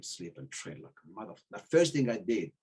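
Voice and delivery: normal talking voice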